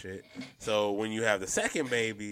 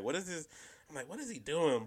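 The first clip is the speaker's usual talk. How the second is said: mildly baffled, softer and higher; 6 semitones higher, 8 dB quieter than usual. Retold or imitated. Viewer wondering what the actor is doing, retold bafflement. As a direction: retell it as a hushed, puzzled question.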